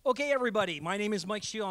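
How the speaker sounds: usual speaking voice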